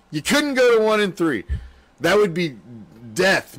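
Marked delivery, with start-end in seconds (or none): 0.1-1.4 emphatic repeat. Real, not acted.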